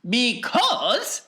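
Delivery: high, lively showman voice; Wonka's high, bouncy showman voice; eccentric chocolatier. Acted. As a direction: play the excitable factory owner, pitched well up. Wonka answers.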